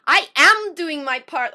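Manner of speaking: loud pleading outburst; crying out. Retold or imitated. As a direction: cry it out loud in frustration. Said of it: "I cry".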